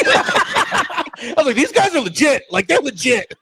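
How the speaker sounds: giggling while retelling; laughing. Real, not acted.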